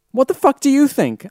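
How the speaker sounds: loud, high indignant outburst; exasperated, high-pitched snap; angry retort. A narrator's reading. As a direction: throw it back in a hammed-up fury. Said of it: "I answered angrily".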